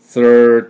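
"thir" held long on the r; very slow, stretched "thir"; drawn-out thir. Shown as a demonstration. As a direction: pull "thir" back into a long r. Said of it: "と引いてきます。".

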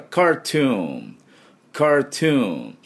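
slow American cartoon with firm r; r-coloured American "カートゥーン". Shown as a demonstration. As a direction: say "カートゥーン" American, r fully sounded. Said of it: "アメリカは、えー、Rをしっかり入れます".

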